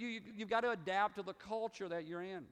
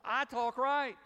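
higher, louder, mock-proud retort; playful indignation; 6 semitones higher, 6 dB louder, slower than usual. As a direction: answer with hammed-up Southern pride. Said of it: "You have an accent".

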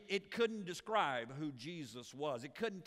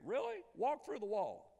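sky-high squeaky "Really?" then a dry dare; incredulous mock; 5 semitones higher than usual. Pastor teasing a churchgoer with disbelief. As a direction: scoff in disbelief, pitch jumping way up.

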